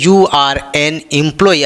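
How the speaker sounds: You are an employee drawn out word by word; slow dictation. Shown as a demonstration. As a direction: read it out slowly so students can write it. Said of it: "आगे लिखता है". (धीरे-धीरे लिखवाते हुए)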